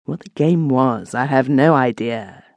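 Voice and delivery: usual speaking voice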